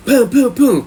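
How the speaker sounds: high, loud booms imitating knocking; booming knock noises. Imitating a neighbor pounding the cell door, loud booms. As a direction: punch out three loud knocks vocally.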